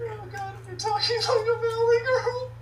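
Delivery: high laughing through words. Real, not acted. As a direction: laugh while talking. Laughing at valley girl voice.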